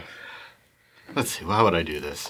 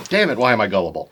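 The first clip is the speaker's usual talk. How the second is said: ordinary talk, nothing marked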